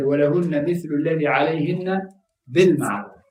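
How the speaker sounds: quick recited Arabic verse; Quran recitation. Acted. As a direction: chant the verse swiftly.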